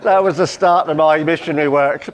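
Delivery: laughter spilling into the words; real laughter. Real, not acted. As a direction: carry on while still laughing at your punchline. Laughing at his own punchline.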